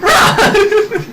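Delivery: high, loud panicked yell; panicked shout. Acted. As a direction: scream it in mock terror. Player character fleeing in panic.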